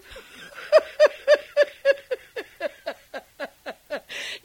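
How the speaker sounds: helpless high-pitched laughter; long high laugh. Acted. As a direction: laugh till you can't stop. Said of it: "I cannot choose but laugh".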